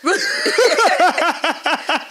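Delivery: high, loud burst of laughter. Real, not acted. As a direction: burst out laughing at your own joke. Reacts to own sacrifice joke.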